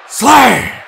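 high, very loud battle cry; monster's loud killing cry; monstrous shout. A narrator's reading. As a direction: roar it out as an attack.

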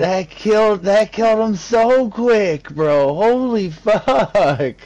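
high, loud disbelief through laughs; laughing loudly. Real, not acted. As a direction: cackle out the words. Reacting to kill, both laughing.

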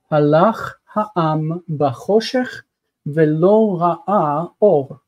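slow, syllable by syllable verse reading; slow careful reading. Shown as a demonstration. Teacher reads the Hebrew verse slowly for students, carefully. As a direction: read it out slowly for the class. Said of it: "slow repeated reading of verse".

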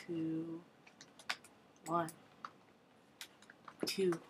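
usual speaking voice

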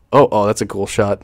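ordinary talk, nothing marked

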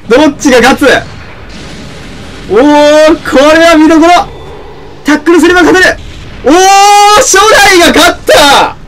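high, loud shout of victory; excited shouting. Real, not acted. Streamer cheering the fight's winner, loud excited shouting. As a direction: roar it as the winner is decided. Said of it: "声結構張り上げちゃった".

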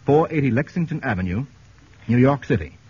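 ordinary talk

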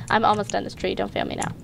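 ordinary delivery

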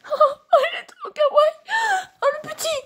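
high squeaky cooing over a cute animal. Real, not acted. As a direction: squeal with delight, voice cracking. Cooing over a baby ferret.

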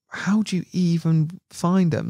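high, slow disbelieving question; wide-eyed mock disbelief; incredulous. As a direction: ask it like you can't believe it. Incredulous quote of fellow angler.

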